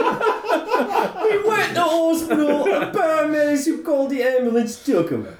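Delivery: high, giggling fake British accent; hooligan accent. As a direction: stay in the hooligan voice through the laughter. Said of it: "rate his accent".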